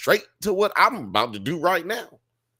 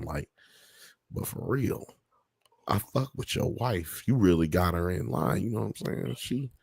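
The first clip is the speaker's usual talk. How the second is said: lower, softer, sly murmur; a lightly voiced coworker sweet-talking about the wife; 5 semitones lower, 4 dB quieter than usual. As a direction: lean in like a shady coworker. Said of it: "dudes at work being like".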